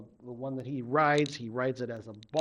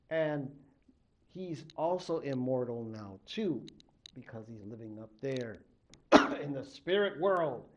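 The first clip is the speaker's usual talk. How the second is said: usual speaking voice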